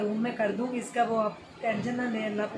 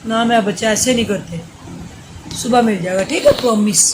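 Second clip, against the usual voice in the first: mother hushing her crying son late at night, loud and pleading; 11 dB louder than usual. Real, not acted. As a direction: soothe and coax him out of tears, raised over the crying.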